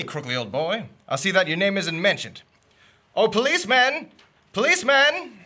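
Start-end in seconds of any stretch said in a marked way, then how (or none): 3.1-5.4 calling out. Acted. Hailing the police.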